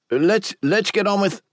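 high, strained voice fighting a cough; choking back a cough. Acted. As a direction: push the words out past a cough. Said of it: "he choked back a cough".

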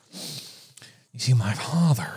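whispered menacing villain; whispered live-action Joker. Acted. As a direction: softly menace like a movie Joker. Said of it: "a live action Joker".

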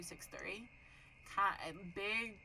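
plain reading voice

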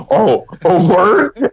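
very slow, drawn-out quote; mock drawn-out reply. Friend teasing Johnny's reply, slow drawn-out mock. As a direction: stretch every word out slowly. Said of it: "You straight up were like".

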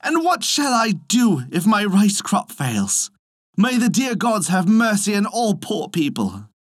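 high-pitched peasant's plea; storybook lament, pleading to the gods; lamenting cry. A narrator's reading. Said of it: "cried the poor peasant man".

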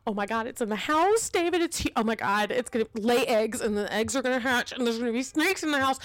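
retold meltdown, racing and rising; panicked rambling. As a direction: spiral into mock panic, words tumbling out. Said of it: "talking a mile a minute".

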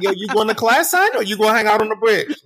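high-pitched mock New York accent; New York impression. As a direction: overdo the New York student act. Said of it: "y'all come across campus like".

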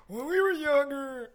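put-on slow, high creaky old-timer voice; little grandpa. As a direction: play a rambling old grandpa. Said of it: "you are a little grandpa with the stories".

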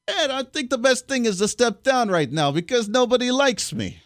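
lightly voiced mock politician, a touch high; mocking Cuomo impression. Host mocking the governor, lightly voiced tool. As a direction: parody him stepping down, half-hearted. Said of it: "Does he sound like a tool?".

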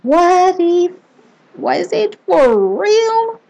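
high, slow, stunned inner voice; shocked disbelief. Acted. Reader reliving the creepy encounter, high slow shocked disbelief. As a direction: gasp it out like you can't believe it happened.

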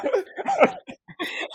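high squealing burst of laughter; helpless giggling fit; giddy laughter. Real, not acted. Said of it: "I can't stop laughing".

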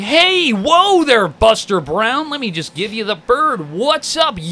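high, drawn-out mock road rage; hammed-up, dorky attempt at yelling at a driver; whitest road rage. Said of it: "widest road rage I've ever seen".

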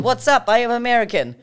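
loud, high mock Russian greeting; hammed-up Russian-accented hello. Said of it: "in a Russian accent".

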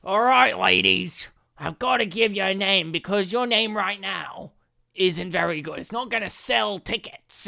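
put-on showbiz manager pep talk; hammed-up manager addressing the act; band manager. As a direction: play a pushy band manager. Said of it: "If you were their manager and you're like".

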